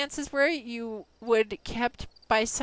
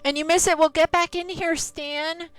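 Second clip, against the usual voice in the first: calling out; 5 semitones higher, 4 dB louder than usual. Real, not acted. Calling a returning viewer back.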